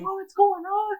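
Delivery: re-enacting panic as his favorite dies; squeaky panic. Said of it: "I was like".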